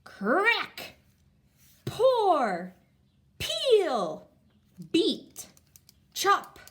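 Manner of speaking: each word called out high and loud; excited. Shown as a demonstration. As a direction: shout every action word with delight. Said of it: "so we'll say 'em like we're excited".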